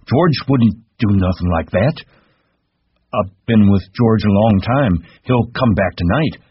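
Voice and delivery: cling to the belief George returns; upset insisting. A narrator's reading.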